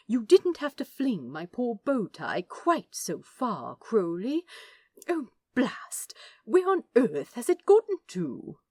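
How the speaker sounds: a flustered, proper angel fussing; fussy Aziraphale. Acted.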